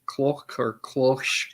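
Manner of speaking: "cloche or cloche" said slowly, first with a hard K ending, then SH; trying out pronunciations. Shown as a demonstration. Host puzzling over a hat name, trying "cloche" as klock, then klohsh. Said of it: "don't even know how to pronounce this".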